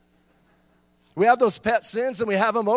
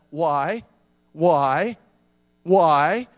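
building from normal to loud, each why louder; God's repeated why; 2 semitones lower, 6 dB louder, much slower than usual. Acted. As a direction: press harder with every why. God asking why, voiced by preacher.

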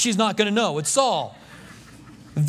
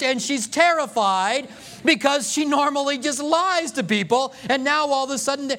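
usual speaking voice

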